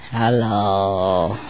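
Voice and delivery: low, drawn-out hello; parrot talking in dream. Retold or imitated. Parrot talks in a dream near the dogs, low voice. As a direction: drop deep and stretch it out. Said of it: "they talk in a dream".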